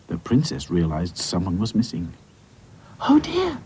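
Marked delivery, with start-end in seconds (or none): 3.1-3.6 high-pitched dainty alarm. Acted. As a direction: fret aloud in a high doll voice. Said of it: "she cried".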